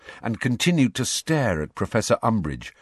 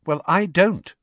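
blunt, loud and high schoolgirl; 10 semitones higher, 6 dB louder than usual. Acted. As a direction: cut it off flatly. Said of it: "said Hermione bluntly".

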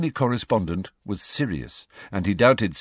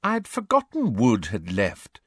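slow, absent-minded musing; vague, pitched-up remark; 4 semitones higher than usual. A narrator's reading. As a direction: say it slowly, as a drifting afterthought. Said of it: "said Hermione vaguely".

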